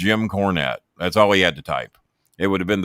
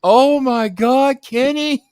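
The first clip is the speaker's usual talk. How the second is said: much higher, louder, slow gleeful squeal; voicing ecstatic Russo; 8 dB louder, much slower than usual. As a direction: gloat with delight. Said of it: "So Russo is ecstatic".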